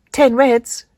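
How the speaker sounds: put-on high, bright child's voice; little girl. Girl in red reporting her team, high child voice. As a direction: call it out like a small girl.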